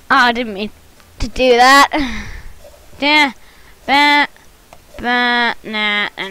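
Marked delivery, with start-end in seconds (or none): none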